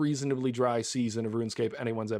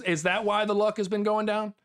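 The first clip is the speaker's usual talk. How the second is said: ordinary talk, nothing marked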